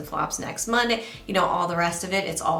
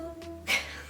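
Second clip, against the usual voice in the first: soft giggle; 10 semitones higher, 9 dB quieter than usual. Real, not acted. Laughs after jingle.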